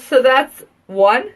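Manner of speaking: ordinary delivery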